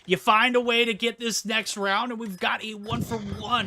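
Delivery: loud, high hyped casting; hyped shout. Real, not acted. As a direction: yell it like a big play. Hyped play-by-play, manager clutch.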